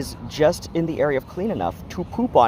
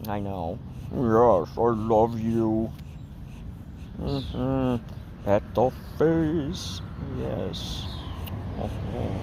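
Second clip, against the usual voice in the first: pet talk; 6 semitones lower, 7 dB quieter, slower than usual. Real, not acted. Cooing while petting cat.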